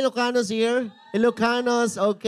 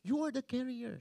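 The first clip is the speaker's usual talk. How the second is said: much softer, toned-down delivery; hushed, restrained restatement; quiet repeat; 4 semitones higher, 11 dB quieter than usual. Real, not acted. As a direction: say it softly, as if backing off a shout. Soft repeat after shouting.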